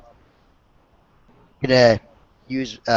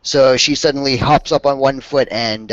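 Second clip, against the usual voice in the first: normal talking voice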